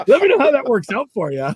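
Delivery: high, loud mocking send-off; taunting the rival angler, loud and sarcastic; loud sarcasm. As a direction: rub it in with a grin. Mock send-off to Kevin.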